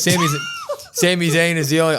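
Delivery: badly faked accent, high and loud, breaking into laughter; Drew McIntyre accent. Podcaster mimicking a wrestler's taunt for the co-host, botched accent. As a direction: attempt the wrestler's accent and crack up. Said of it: "I can't do accents".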